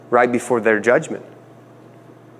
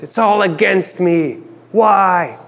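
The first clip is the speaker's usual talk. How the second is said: despairing Jacob; 6 semitones higher, slower than usual. Retold or imitated. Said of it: "Jacob declares".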